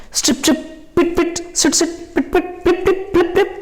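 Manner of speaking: high, light pitter-patter; rapid high pip-pip, partly voiceless; imitated rain sounds. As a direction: tap out rain in tiny squeaky syllables. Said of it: "इतना सारे sound आया".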